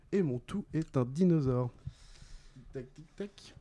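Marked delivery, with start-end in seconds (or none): 2.5-3.5 very soft, slow tick-tock; ticking clock imitation. Quizmaster waits for answers, softly ticking.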